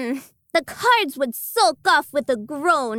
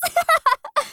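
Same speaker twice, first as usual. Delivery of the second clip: giggle; 11 semitones higher than usual. Acted. Laugh alone, far.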